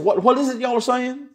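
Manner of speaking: curious question. Retold or imitated. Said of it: "David's asking this question".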